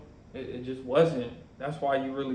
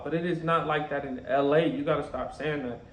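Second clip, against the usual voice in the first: ordinary delivery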